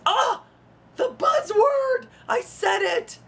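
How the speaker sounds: high-pitched mock-scandalized cry; hammed-up gasping alarm, drawn out; mock horror. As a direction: feign shock at a forbidden word, pitch leaping up. Mock horror at saying social justice.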